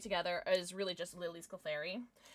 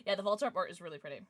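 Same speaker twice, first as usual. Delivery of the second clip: ordinary talk, nothing marked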